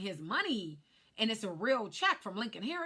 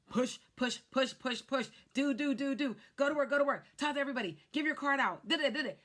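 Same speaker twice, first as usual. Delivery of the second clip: imitating the husband, higher and louder; wife mimicking her husband's pep talk, loud and pushy; 6 semitones higher, 4 dB louder, faster than usual. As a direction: nag her to hustle, hammed-up. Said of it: "My husband is like".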